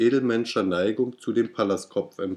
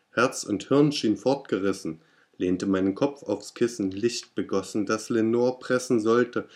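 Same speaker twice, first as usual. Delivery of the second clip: usual speaking voice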